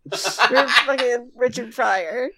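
slow words broken by laughter; laughing. Real, not acted. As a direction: laugh through the name. Laughing through the line.

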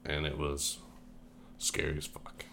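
hushed, fading to a breath; trailing off. Real, not acted. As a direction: let the words die to a whisper. Hushed scary recollection.

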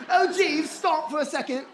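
high, hammed-up British king; exaggerated British accent. King stopping his servant, replayed for laughs in mock British. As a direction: ham up the British accent, pitched well up. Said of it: "he wasn't British".

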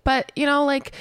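ordinary talk, nothing marked

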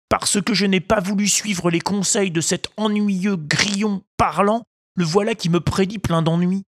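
put-on high, boastful puppet boy; a cocky little boy grumbling to himself; Pinocchio voice.

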